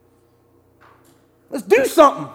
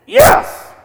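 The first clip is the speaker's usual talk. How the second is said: a burst of joy at the resurrection; excited yes; 12 semitones higher, 5 dB louder than usual. Real, not acted. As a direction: shout it out high and loud, jubilant. Preacher exults over the resurrection.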